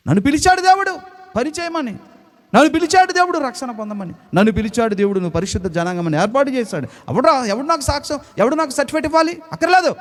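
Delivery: high, ringing preacher's cry; fervent preaching. Acted. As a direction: proclaim it, rising to a pitch. Preaching at full force.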